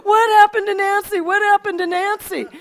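loud, high cries of people searching; imitated frantic yelling. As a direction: yell it out like a panicked search party. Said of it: "screaming and yelling".

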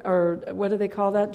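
ordinary talk, nothing marked

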